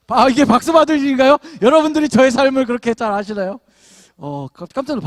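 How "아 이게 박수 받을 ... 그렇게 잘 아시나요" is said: loud, playful protest at the clapping; mock surprised protest. Real, not acted. Pastor teasing the applauding congregation, loud and playful. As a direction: act startled by the applause, half joking.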